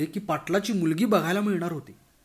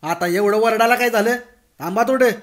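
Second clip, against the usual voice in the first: louder, annoyed snap back; irritated retort; 5 semitones higher, 7 dB louder than usual. Acted. The girl annoyed at Bhagwan's shouting, irritated louder retort. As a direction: snap at him for yelling.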